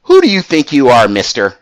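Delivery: a hint of an indignant challenge in a little voice; little Stacy voice. Stacy takes the phone from the girl, lightly mimicked little scolding voice. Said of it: "in that little Stacy voice".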